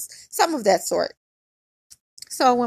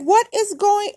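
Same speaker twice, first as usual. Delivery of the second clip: retold alarm; 11 semitones higher, 4 dB louder than usual. Retold shock while being pulled out.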